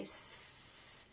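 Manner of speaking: long sss hiss for S; soft, sustained S hiss; snake-like S. Shown as a demonstration. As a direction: hold S like a hissing snake. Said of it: "like a snake would say".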